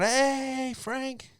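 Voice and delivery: high friendly mock greeting; cop waving on. Cop brother greets drunk firefighter, high mock friendly. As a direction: wave a buddy through, chummy. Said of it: "He's just gonna wave you on".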